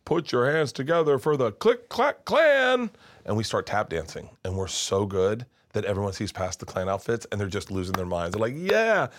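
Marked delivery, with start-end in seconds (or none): 0.0-2.9 showman's intro building higher and louder; loudspeaker announcer. Acted. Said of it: "this voice on the loudspeaker goes". 8.6-9.1 high, loud whoop of approval; cheering crowd. Acted. Audience losing their minds, acted.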